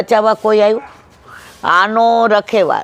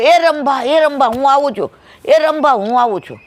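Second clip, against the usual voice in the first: high, ringing call to a cow; 7 semitones higher than usual. Acted. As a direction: shout reassurance across the field. Herdsman calling his cow.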